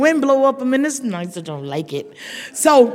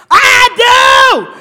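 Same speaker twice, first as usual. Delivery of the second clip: loud high shout in church; 13 semitones higher, 10 dB louder than usual. Retold or imitated. Giving the bride away, shouting over the aunt. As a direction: blast it so the whole church hears. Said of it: "I said it so loud".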